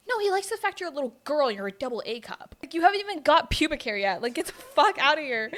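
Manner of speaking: acted-out scolding with rising force; retold telling-off. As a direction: lay into her, loud and indignant. Acted telling-off of her sister.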